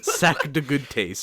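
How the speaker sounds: slow, giggly mock French accent; playful hammed-up French accent. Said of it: "sorry for my French accent".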